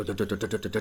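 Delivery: ordinary talk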